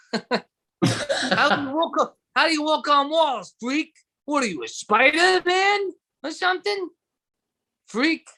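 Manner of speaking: high, slow sneering bully voice; mock bully. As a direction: sneer at Spider-Man like a street bully. Bully taunting Spider-Man.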